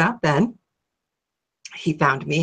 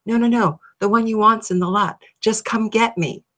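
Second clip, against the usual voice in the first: higher, coaxing voice of the car; car calling her; 5 semitones higher than usual. Retold or imitated. The dream car calling to her from the lot, coaxing. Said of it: "I hear this car calling me".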